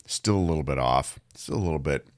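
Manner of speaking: hoarse from a cold; rough, raspy cold-worn voice; gravelly voice. Real, not acted. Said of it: "and the gravelly voice".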